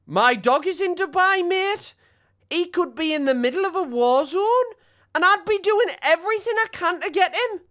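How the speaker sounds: a squeaky, overdone Geordie voice for the stranded dog owner; Newcastle accent. Retold or imitated. Streamer mocks a stranded dog owner in an over-the-top Newcastle accent. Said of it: "It wasn't a great Newcastle accent at first".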